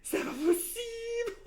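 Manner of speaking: very high squeal breaking into laughter; real hysterical delight; overwhelmed disbelief. Real, not acted. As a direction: wail it in joyful shock, then shriek with laughter. Overcome by the god pack.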